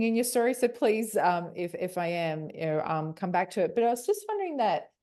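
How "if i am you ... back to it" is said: normal talking voice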